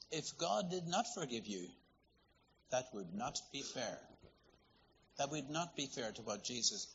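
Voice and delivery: ordinary delivery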